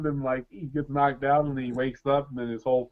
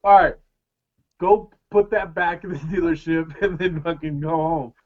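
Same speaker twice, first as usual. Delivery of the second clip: mock stern cop; 4 semitones higher, 9 dB louder than usual.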